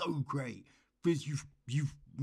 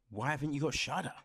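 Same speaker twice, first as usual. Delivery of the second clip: ordinary talk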